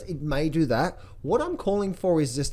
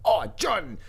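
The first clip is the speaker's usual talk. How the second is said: mock viewer; 7 semitones higher than usual. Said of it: "look where we are".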